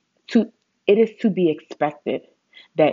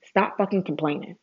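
usual speaking voice

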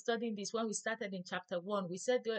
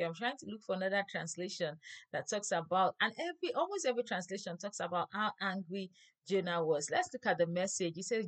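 ordinary talk

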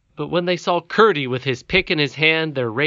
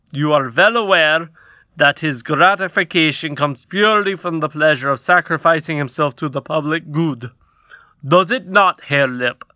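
louder, pompous goblin king; 3 semitones higher, 5 dB louder than usual. A narrator's reading. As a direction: lecture on state policy. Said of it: "the king said".

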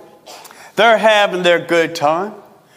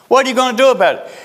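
pressing challenge; 4 semitones higher, much faster than usual. Retold or imitated. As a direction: fire the question back at him fast and hard. Repeated challenge, pressed harder.

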